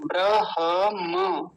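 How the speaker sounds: ब्रह्म broken out as "bra-ha-ma"; deliberate syllable-by-syllable ब्रह्म; h before m. Shown as a demonstration. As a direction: spell out ब्रह्म slowly, h sounded before m. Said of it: "ह पहले म बाद में".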